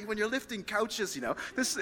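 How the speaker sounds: ordinary talk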